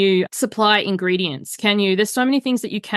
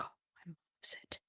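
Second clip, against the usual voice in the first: breathy whispered aside, hurt; whispered upset; 24 dB quieter, faster than usual. Retold or imitated. Retelling her mum using up the perfume, whispered. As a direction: barely voice it, a mock wounded whisper.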